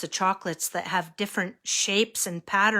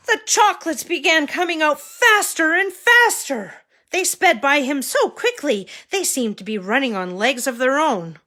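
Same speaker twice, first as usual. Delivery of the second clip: high, loud and rushing; excited narration; 7 semitones higher, 7 dB louder than usual. A narrator's reading. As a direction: build up the chaos loudly. Chaos in story.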